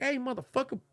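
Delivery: high, confrontational mock challenge; aggressive. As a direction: act out calling someone out, pitch jumping up. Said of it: "That was aggressive and shit".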